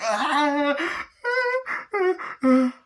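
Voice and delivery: high, gasping whimpers; hammed-up gasping cry; mock crying. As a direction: imitate horrified sobbing breaths. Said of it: "she starts crying".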